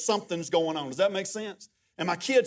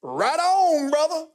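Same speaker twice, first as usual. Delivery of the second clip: high, loud congregant's shout; imitating an exuberant outburst in church; hollered affirmation; 9 semitones higher, 6 dB louder, slower than usual. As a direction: holler approval from the front row. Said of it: "And man, he'd go".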